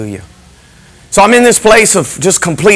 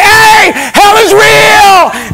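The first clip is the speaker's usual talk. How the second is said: high, slow, loud yell; wild shouted warning; belligerent shout; 13 semitones higher, 3 dB louder, much slower than usual. Retold or imitated. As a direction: bellow it at the nurses. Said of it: "I'm, like, belligerent".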